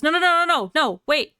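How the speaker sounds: high, loud stream of protest; acted mounting panic; panicking. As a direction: frantically plead for them to stop. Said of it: "he kinda starts panicking".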